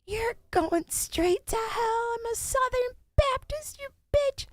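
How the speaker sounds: very high, slow squeaky mock voice; mock Southern Baptist lady. Streamer mocking a chat troll, very high squeaky voice. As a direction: squeal it like an outraged church lady. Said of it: "this chick came in and she was like".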